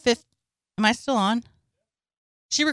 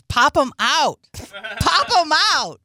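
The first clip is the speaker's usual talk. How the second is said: loud, higher excited chant; 4 semitones higher, 5 dB louder, slower than usual. Retold or imitated. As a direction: cheer it on, mimicking his excitement. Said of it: "Like, he was excited".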